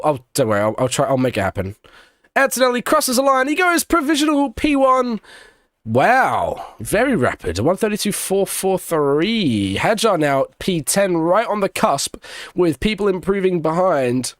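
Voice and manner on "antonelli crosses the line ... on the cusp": raised racing-commentator call, easing down; live play-by-play. Real, not acted. Commentator announcing provisional pole, live play-by-play. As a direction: call the new fastest lap like a live commentator.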